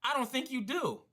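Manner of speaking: imitated stranger, high-pitched contradiction; doubting busybody. Stranger doubts the worker's permission, imitated officious doubt. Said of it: "He's like".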